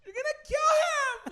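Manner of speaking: very high, drawn-out shout; slow, piercing pretend yell; mock scream. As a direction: shriek a desperate warning, hammed up. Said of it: "I couldn't be like".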